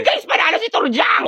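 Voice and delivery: high triumphant cheer; excited cheer. Acted. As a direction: celebrate the win. Cheering the winner. (masayang sigaw)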